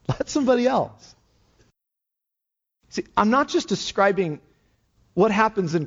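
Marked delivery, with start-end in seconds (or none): 0.1-1.2 high, laughing echo of letting somebody else do it; laughing mock shrug. Preacher echoing the lazy thought, laughing. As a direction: toss it off with a laugh.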